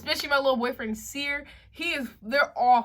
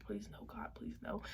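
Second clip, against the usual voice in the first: breathy whispered plea, very soft; whispered prayer; 18 dB quieter than usual. Retold or imitated. Scared friend whispering a prayer for help, hushed whisper. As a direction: beg God under your breath. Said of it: "I was really praying".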